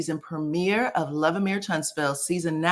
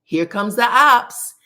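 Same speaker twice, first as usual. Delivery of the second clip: loud, drawn-out taunting call; retold scream; 3 semitones higher, 8 dB louder, slower than usual. Shanita screaming out as Tisha comes, slow loud call. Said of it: "she screams out".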